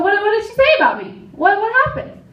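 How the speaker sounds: hearing a friend trashed you, startled and rushing; alarmed surprise. Retold or imitated. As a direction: blurt it out in a startled rush. Alarmed at news of gossip.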